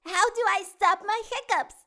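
high, loud and quick little cat voice; eager kitty voice. A narrator's reading. Pete the kitty pleading with Gus, eager and high. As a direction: plead fast and bright like a small kitten. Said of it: "Pete asks Gus".

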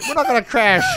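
usual speaking voice

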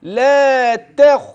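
لا تخ chanted slowly, thin ت moving into thick خ; light tāʾ to heavy khāʾ. Shown as a demonstration. Teacher showing the move from light ت to heavy خ in لا تخ. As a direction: draw out لا, then thicken only at خ. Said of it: "الانتقال من التاء المرققة".

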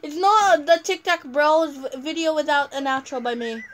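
loud playful protest. Real, not acted. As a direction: insist loudly. Protesting being left out.

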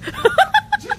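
high, strained laughter through the words; laughing hard. Real, not acted. Struggling to crab walk on stream, laughing hard. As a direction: laugh breathlessly mid-effort.

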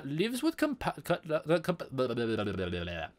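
put-on botched not-quite-Indian accent that collapses into blah-blah; attempted Indian accent. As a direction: stumble through a dubious Indian accent, giving up. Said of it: "but not really Indian accent".

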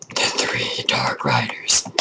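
low slow whispered title; dramatic whisper. Acted. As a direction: whisper it like a trailer.

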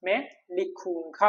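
slow, careful demo of മേ ലിഖൂങ്ക with each syllable drawn out; slow model phrase. Shown as a demonstration. Teacher showing students the Hindi for I will write, slow. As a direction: draw out മേ ലിഖൂങ്ക for the class. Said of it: "അപ്പൊ എങ്ങനെയായിരിക്കും ഹിന്ദി പറയാം?".

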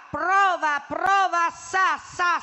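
loud testing calls down a bad line; shouting into the phone. Old woman shouting a phone test to her friend, loud. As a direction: call out loud to check the line works. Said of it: "Non gridare!".

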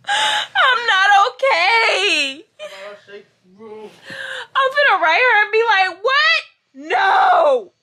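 loud high sobbing shout, slowed; crying. Real, not acted. Vlogger crying with joy, telling friend she's not okay. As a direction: wail it out half laughing. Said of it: "Why did you start crying".

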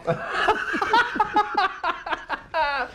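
high, drawn-out laughing fit; big laugh. Real, not acted. Host cracks up after refusing a lecture, laughing. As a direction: burst into a long high laugh. Laughing at his own remark.

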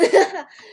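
sudden high loud laugh; high laugh burst. Real, not acted. Laughing at own joke.

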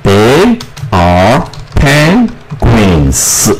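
"They are penguins" stretched out very slowly; very slow dictation. Shown as a demonstration. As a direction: read it slowly while writing on the board. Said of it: "我们就把答案写在这边". (很慢地边写边念)

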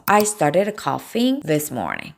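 slow, word by word; slow model sentence. Shown as a demonstration. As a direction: read it slowly for the class. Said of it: "나 오늘 아침에 기침 시작했어". (천천히 또박또박 예문 읽기)

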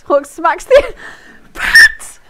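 high, loud shout breaking into laughter; loud outburst. Real, not acted. Streamer shouting the story line while laughing. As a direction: blurt it out laughing.

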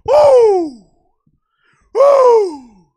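high, loud whoop; loud cheer. Real, not acted. Streamer cheering at chat, loud whoop. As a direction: let out a big whoop.

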